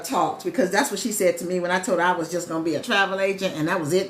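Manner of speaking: normal talking voice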